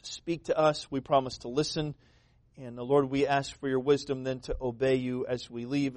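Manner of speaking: soft, low closing prayer; a gentle pastoral prayer voice. Acted. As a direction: pray quietly to the Lord, hushed and earnest.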